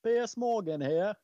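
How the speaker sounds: high, slow, loud British presenter impression; Piers Morgan. Presenter introducing himself to listeners, high mock-British voice. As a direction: announce yourself in a put-on British accent. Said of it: "Say it in a British accent".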